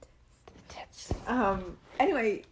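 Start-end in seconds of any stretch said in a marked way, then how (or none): 0.0-1.1 breathy slow whisper. Acted. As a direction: whisper it back slowly. Mock echo whisper.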